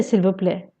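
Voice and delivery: ordinary talk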